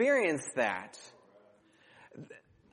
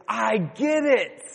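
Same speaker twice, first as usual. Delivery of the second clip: put-on child's realization; 5 semitones higher, slower than usual. Child voiced in story.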